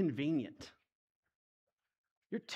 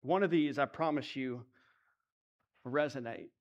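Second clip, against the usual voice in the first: ordinary talk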